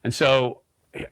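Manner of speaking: usual speaking voice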